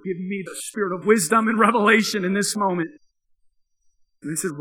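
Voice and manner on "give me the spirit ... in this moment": raised voice, pleading to God; retold prayer for wisdom, louder; urgent prayer. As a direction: call out for help from the Lord. Urgent quick prayer within story.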